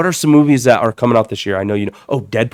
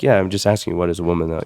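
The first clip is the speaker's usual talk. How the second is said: deadpan monotone, a bit lower; Matt Walsh monotone; 6 semitones lower, 6 dB quieter, faster than usual. Retold or imitated. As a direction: ask calmly, no emotion at all. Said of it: "his monotone makes".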